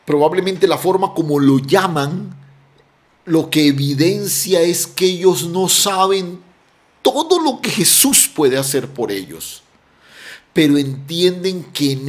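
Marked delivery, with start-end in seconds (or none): none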